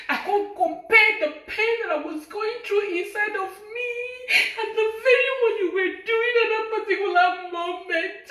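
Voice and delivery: crying through the words, half laughing; breaking into tears. Real, not acted. Speaking to her partner, breaking down in real tears. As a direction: voice cracking, high and strained as tears come. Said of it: "I'm not supposed to get emotional".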